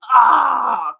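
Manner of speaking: loud mock groan of achy bones; imitated loud, achy "ahh". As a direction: cry out as if stretching stiff joints. Retold groan at achy morning stretches.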